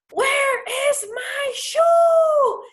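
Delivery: high, drawn-out shout; screaming. Acted. Teacher screams an example for an exclamation point, drawn-out shout. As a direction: stretch each word into a loud, high yell. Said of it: "they are screaming".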